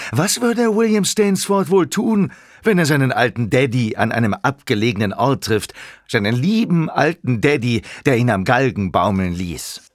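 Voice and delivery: high opening, settling into sarcasm; scornful, theatrical rhetorical question; mocking taunt. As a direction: taunt him with feigned curiosity. Villain taunts his creator.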